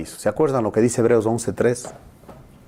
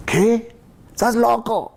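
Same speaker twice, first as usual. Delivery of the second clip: incredulous scoff; 12 semitones higher, slower than usual. Retold or imitated. Skeptic mocks believer.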